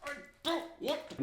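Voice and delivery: high, mock-whiny passing of the buck; hot potato squabble. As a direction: toss the blame back and forth in a high, put-on voice. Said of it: "a game of, like, hot potato".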